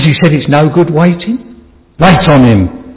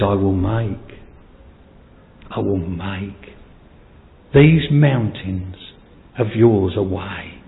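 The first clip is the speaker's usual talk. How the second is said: softer, slow, hushed voice of the Lord; tender promise; 7 semitones lower, 8 dB quieter, slower than usual. Acted. Preacher voices the Lord's promise to believers, hushed and slow. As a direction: let the promise fall gently and slowly.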